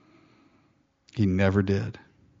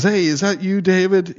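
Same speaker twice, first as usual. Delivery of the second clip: feigned friendliness; 7 semitones higher than usual. Retold or imitated. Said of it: "he's playing this part".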